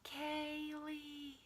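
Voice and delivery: soft, eerie call of her name; aisle calling again. Pretend. The aisle calls her a second time, soft and eerie. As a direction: lure her in, like a ghost. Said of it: "And I heard it".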